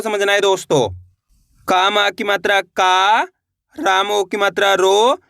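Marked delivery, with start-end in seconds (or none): 1.7-5.2 slow sounding-out, holding "का" and "रो" long with a rising end; syllable-by-syllable spelling. Shown as a demonstration. Teacher showing learners how each letter is pronounced, slowly spelled out. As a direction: draw out "का" and "रो" as a reading drill. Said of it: "एक एक अक्षर का उच्चारण किस तरीके से किया जाता है".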